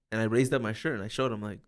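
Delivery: ordinary talk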